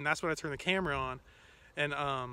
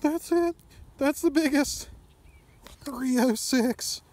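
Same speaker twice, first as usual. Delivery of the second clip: loud, high, stretched-out reading of the weight; real joy at a personal best; excited shout; 8 semitones higher, 6 dB louder, slower than usual. Real, not acted. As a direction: call out the number like a record. Reads scale, personal best.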